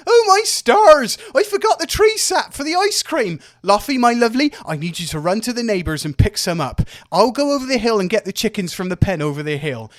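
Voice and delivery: high, loud frantic mother, fast at the end; a mum in a tizzy barking errands; flustered mum. Acted. Mum Brenna rushing out, flustered, high and loud. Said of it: "runs out of the house in a fluster and says".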